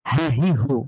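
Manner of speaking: হা হি হু pressed from mid-throat; হা হি হু with a tight throat. Shown as a demonstration. Teacher contrasts the throat হা হি হু, pressed. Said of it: "কণ্ঠের মাঝখান থেকে".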